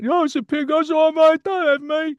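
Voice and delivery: very high, loud cartoon pig; squealing cheeky pig; big pig voice. Actor demoing his Peter Rabbit pig, very high and loud. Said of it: "I play a big pig".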